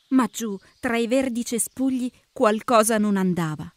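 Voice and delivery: plain reading voice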